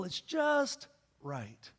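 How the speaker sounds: very slow, savoring each word; drawn out. Acted. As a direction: stretch it out like the end of a fairy tale. Goldilocks punchline, drawn out.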